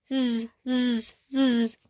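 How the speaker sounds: soft low snores; snoring sound. Acted. Sleepy kitties snoring softly. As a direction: breathe out slow sleepy zzz.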